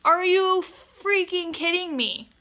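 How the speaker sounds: can you believe this guy; outraged disbelief. Real, not acted.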